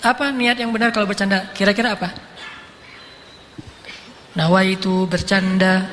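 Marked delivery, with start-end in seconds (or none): 4.3-5.9 slow, measured mock formula; mock solemn recital. Joking about formally declaring intent before kidding, mock solemn recital. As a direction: recite it slowly like a ritual intention.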